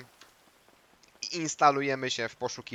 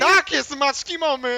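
gloating; 14 semitones higher, 10 dB louder, slower than usual. Acted. Mocking beaten opponent.

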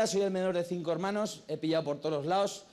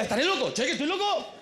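high, mounting comic alarm; hammed-up panic; 10 semitones higher, 4 dB louder than usual. Son driven mad by his mother, hammed-up panic. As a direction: climb higher as you doubt your sanity.

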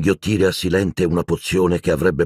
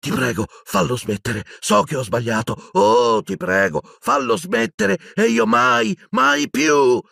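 desperate pleading; 14 semitones higher than usual. A narrator's reading. Dumbledore begging in agony.

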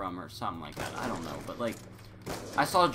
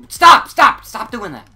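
sudden, much higher, much louder Australian-rant-stopping yell at himself; 9 semitones higher, 19 dB louder, slower than usual. Real, not acted. Gamer yells at himself to stop rambling, sudden shout. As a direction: cut himself off with a startled shout.

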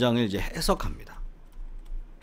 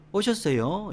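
high Seoul-style polite question; light, raised Seoul lilt; Seoul accent; 8 semitones higher, slower than usual. Shown as a demonstration. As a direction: ask it with Seoul intonation. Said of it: "이 서울 말투는".

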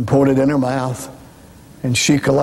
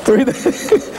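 laughing; 7 semitones higher than usual. Real, not acted. Laughing at himself.

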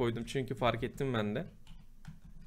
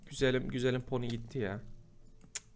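ordinary talk, nothing marked

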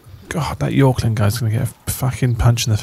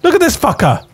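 much higher, astonished exclaiming; amazed; 12 semitones higher than usual. Real, not acted. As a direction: gasp at the giant hare. Huge rabbit appears.

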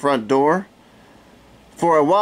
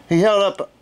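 ordinary delivery, nothing marked

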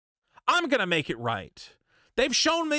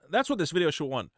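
normal talking voice

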